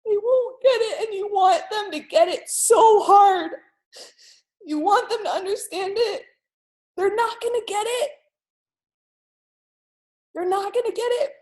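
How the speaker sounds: high, tearful insistence; bawling. Retold or imitated. Uncle consoling his niece about family, bawling. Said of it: "My Uncle Larry's bawling with me".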